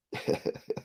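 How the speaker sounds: brief, quiet breathy chuckle; small real laugh under the breath; low chuckle. Real, not acted. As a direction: chuckle softly to yourself. Chuckles while reading the chat.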